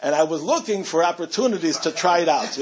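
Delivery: ordinary delivery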